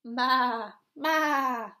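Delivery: loud bleating sheep. Acted. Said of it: "bleated the sheep".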